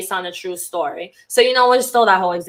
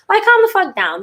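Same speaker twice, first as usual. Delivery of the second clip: higher, fed-up outburst; exasperated; 10 semitones higher, 3 dB louder than usual. Real, not acted. Telling fearful people to calm down, fed up and higher. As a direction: throw up your hands at everyone panicking.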